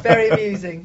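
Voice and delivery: loud, dry sarcastic retort. Real, not acted. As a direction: throw the sarcasm back at him. Retort to a joke about her husband.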